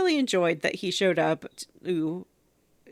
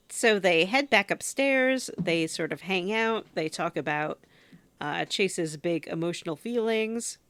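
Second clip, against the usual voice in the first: usual speaking voice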